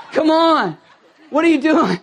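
loud, high-pitched mock exasperation; exasperated outburst. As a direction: protest in comic disbelief at a partner. Imagined quarrel with his wife.

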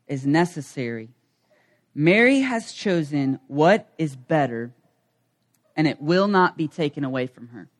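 slow, low reading of the Lord's words; scripture reading. Acted. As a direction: read it slow and reverent. Reads scripture slowly.

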